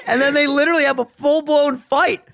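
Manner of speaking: normal talking voice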